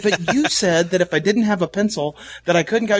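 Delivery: retold childish objection to the teacher; kid protesting. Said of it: "And I said".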